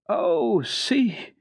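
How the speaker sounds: high, loud thrilled cries; girl crying out in great excitement at the sunset. Acted. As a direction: point it out, bursting with wonder. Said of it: "cried Heidi in great excitement".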